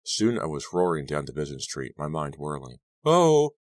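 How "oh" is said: sudden high cry of shock; startled cry. A narrator's reading. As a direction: gasp it out in fright. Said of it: "I cried out".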